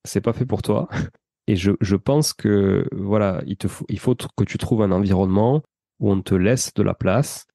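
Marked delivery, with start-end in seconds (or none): none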